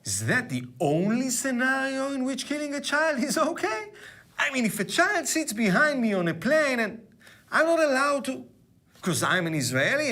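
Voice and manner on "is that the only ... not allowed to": hammed-up incredulity, pitched well up; mock indignant. Host mocks John Oliver's argument, mock indignant.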